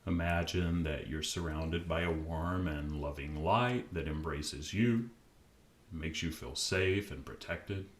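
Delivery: calm, unhurried meditation voice with long pauses; guided meditation. Acted. Podcast host guiding listeners to relax, calm meditation voice. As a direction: ease the listener gently into stillness.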